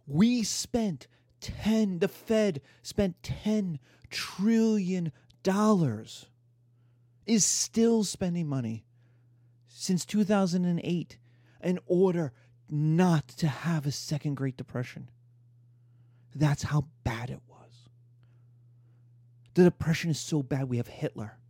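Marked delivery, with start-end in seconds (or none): 0.1-6.3 slow emphasis. Real, not acted. Emphasizing huge spending. 16.4-17.9 grim whispered aside. Real, not acted. Lecturer whispering how bad the Depression was.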